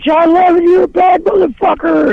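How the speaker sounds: cupped-hands yell from the crowd; retold yell. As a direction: shout it up at the band, hands cupped. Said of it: "cupped my hands around my mouth and I yelled".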